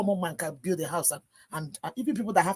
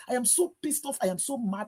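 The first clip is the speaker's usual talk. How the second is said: ordinary talk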